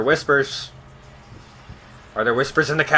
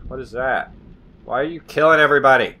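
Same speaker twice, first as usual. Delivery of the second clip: ordinary delivery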